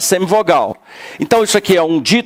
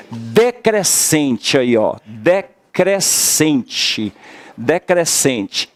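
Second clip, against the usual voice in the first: slow dictation; 2 semitones lower, much slower than usual. Shown as a demonstration. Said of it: "teacher dictates term slowly".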